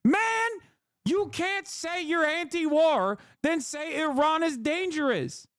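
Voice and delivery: high-pitched, slow, disbelieving; exasperated high slow plea at chat; incredulous exasperation. Real, not acted.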